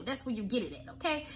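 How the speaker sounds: ordinary delivery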